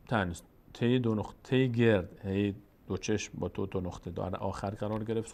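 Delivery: ordinary talk, nothing marked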